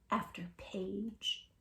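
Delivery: slow whispered after page; whispered slow page-turning; hushed drawn-out. Acted. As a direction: let it trail off softly. Drawn-out storytelling repetition.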